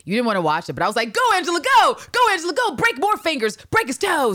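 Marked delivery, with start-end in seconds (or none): 1.1-4.3 loud, much higher cheering; root for her like a fan in the stands. Retold or imitated. Cheering Angela during torture scene.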